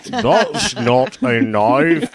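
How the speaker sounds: slow, hammed-up Australian accent; terrible Australian accent. Redoing Crocodile Dundee for laughing friends, exaggerated Australian accent. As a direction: lay a bad Australian accent on thick. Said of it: "that was my Australian accent".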